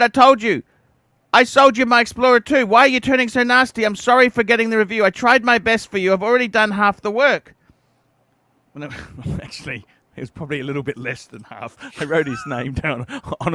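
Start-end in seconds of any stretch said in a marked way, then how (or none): 0.0-7.5 higher and louder hurt protest; defensive reply. Retold or imitated. Host retells his answer to the angry customer, raised and hurt. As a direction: plead your case, raised and wounded. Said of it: "I said".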